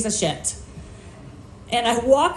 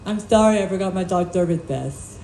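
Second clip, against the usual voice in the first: mock deaf speech with blurred consonants; pretend deaf speaker; 3 semitones lower than usual. Fake deaf customer apologizing to clerk, imitated slurred speech. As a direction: fake a deaf person's articulation. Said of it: "I pretended I was deaf".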